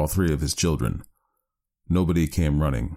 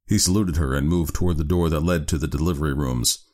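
ordinary delivery, nothing marked

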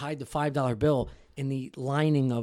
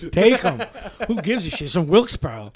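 loud, higher, laughing; laughing joke; 6 semitones higher, 8 dB louder than usual. Real, not acted. Mocking buddy Sam among friends, loud laughing.

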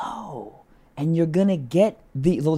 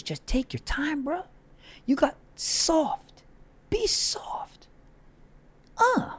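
high, soft, slow whispered coaxing; 10 semitones higher, 6 dB quieter, slower than usual. Acted. Coaching the guys to be soft, in a high whisper. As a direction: breathe it gently.

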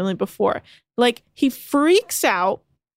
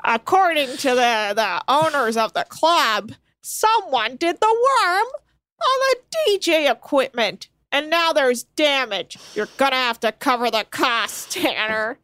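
Captain Lee; 3 semitones higher than usual. Pretend. Captain telling off a crew member over damage, exasperated impression. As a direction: drag out the damage report in mock outrage. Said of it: "Could you imagine Captain Lee?".

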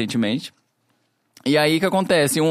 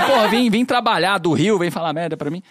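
cameraman irritado; 4 semitones higher, 3 dB louder than usual. Retold or imitated. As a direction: bark the complaint with half a laugh. Cameraman's angry retort, quoted. (imitando o câmera irritado, alto e devagar, rindo)

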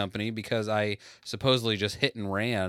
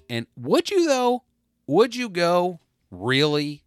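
incredulous; 9 semitones higher, 5 dB louder than usual. Real, not acted. Challenging the audience.

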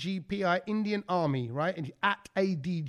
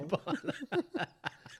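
laughing; 7 semitones higher, 6 dB quieter than usual. Real, not acted. Laughing at own impression.

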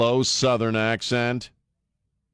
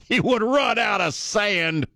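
high, slow mock Southern drawl echoing the senator; Southern accent; 14 semitones higher, slower than usual. Radio host echoing senator's sand joke in mock Southern accent. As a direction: savor it in a put-on Southern drawl. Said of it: "he's from Louisiana".

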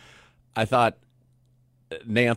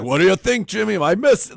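mock commentator outburst, high and loud; loud, high-pitched hammed-up excitement; Romo; 10 semitones higher, 12 dB louder than usual. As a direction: imitate an over-excited broadcaster, pitched way up. Acting out excitable commentator Romo's blurted remark.

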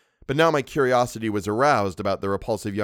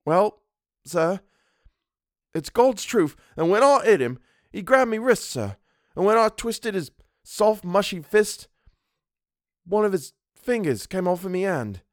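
high-pitched, horrified Cockney, halting; Cockney dialect; 10 semitones higher than usual. A narrator's reading. Servant confessing the gruesome truth, in horrified Cockney. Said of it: "Thomas's Cockney dialect".

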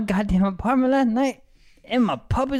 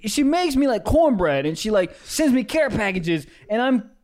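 normal talking voice

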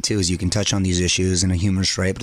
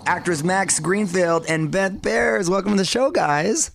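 radio host intro; 8 semitones higher, 4 dB louder than usual. Acted. Radio host opens show.

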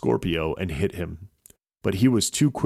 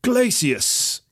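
witch casting a spell; 13 semitones higher than usual. A narrator's reading. Lead witch casting at Drago, high spell cry. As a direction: fling the spell word. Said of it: "pointed her staff and said".

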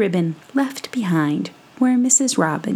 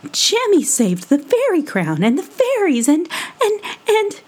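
higher, rushing to defend; defensive protest; 9 semitones higher than usual. Acted. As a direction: sputter in defense of Jemmy. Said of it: "The mourning dove would have none of it".

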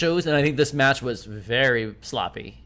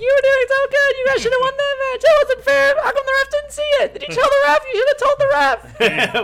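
put-on high, whiny child voice; little kid voice; 4 dB louder, faster than usual. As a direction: talk like an upset little fan. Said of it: "I was a little Mark".

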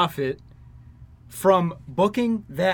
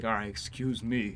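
quiet excuse me; 7 semitones lower, 11 dB quieter, slower than usual. Retold or imitated. Stanley quietly leaving.

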